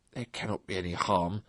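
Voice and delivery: musing quietly as Toad; Toad to himself. A narrator's reading. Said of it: "he said to himself".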